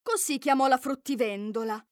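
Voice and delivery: ordinary delivery, nothing marked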